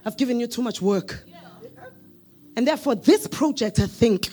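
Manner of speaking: soft, low, kind manager voice; gently relieve a tired employee of the work; gentle boss. Acted. Manager at the boardroom table softly reassures an overworked employee. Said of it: "And then you say".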